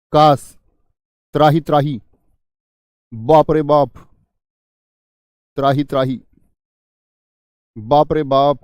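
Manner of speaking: ज़ोर से "त्राही, त्राही" पुकारना; मुसीबत में मदद के लिए चिल्लाना; दुहाई. Shown as a demonstration. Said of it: "शोक को पैदा कर रहा है". (घबराकर ज़ोर से दुहाई दें)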